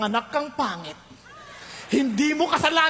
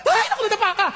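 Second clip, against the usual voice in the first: fussing parent; 7 semitones higher, 3 dB louder, much faster than usual. Retold or imitated. Parent fussing over fallen child.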